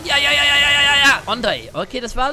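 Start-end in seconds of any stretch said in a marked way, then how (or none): 0.0-1.3 high, loud rapid yeses; excited. Real, not acted. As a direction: cheer yourself on. Excited in fight.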